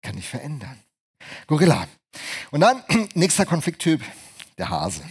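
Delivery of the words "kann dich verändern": softer, lower tender reassurance; Jesus gently. Acted. Preacher voicing Jesus to the listener, soft and tender. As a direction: speak it softly as a loving promise. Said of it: "Und Jesus sagt dir".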